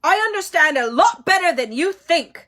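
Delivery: shaky furious retort; trembling anger; voice shaking. A narrator's reading. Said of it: "His voice shook more than ever".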